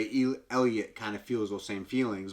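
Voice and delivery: ordinary talk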